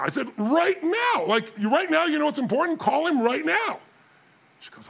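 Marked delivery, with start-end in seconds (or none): 0.4-3.8 high, emphatic retold command; insistent urging. Dad urging daughter to call the agent, insistent urging. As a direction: push hard for action this very moment.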